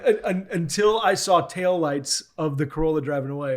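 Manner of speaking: ordinary talk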